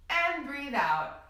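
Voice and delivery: slow release on out; guided breathing cue. Shown as a demonstration. Teacher leading children in deep breaths, slow exhale. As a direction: let the words ride the exhale. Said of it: "Let's take three big deep breaths".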